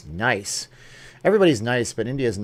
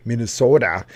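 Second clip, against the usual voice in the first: hammed-up Minnesota accent on MINNESOTA, louder with a long O; 5 dB louder than usual. Shown as a demonstration. Streamer playfully echoing the state name, mock Minnesota accent on MINNESOTA. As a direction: stretch the O in MINNESOTA like a Minnesota local.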